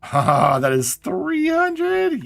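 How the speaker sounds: high, slow, stretched-out delight; thrilled drawl. Real, not acted. Thrilled at big package value.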